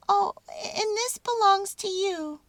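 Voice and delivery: high, squeaky mouse voice; a tiny mouse offering his home. A narrator's reading. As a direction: mouse speaking to the baby statue. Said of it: "he said".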